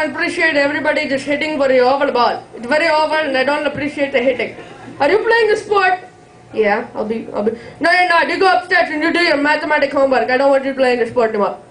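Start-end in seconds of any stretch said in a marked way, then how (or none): 5.0-6.0 lightly voiced strict father questioning; a hint of a dad demanding answers; stern dad. Dad voice confronting son. 7.8-11.6 lightly voiced father laying down the law; a touch of a stern parent; strict dad. Dad voice scolding son.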